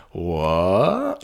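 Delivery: mock-stunned, rising question; baffled disbelief. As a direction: act out a stunned double take. Acting Buffy's reaction to cliffhanger.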